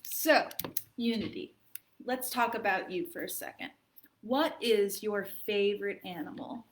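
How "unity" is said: ordinary delivery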